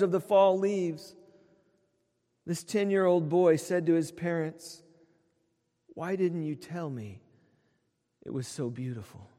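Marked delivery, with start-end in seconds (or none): 5.9-9.3 put-on a soft, awed child's question, much quieter; hushed wonder. Boy to his parents, seeing colors for the first time, hushed and awed. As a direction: breathe it out in quiet amazement.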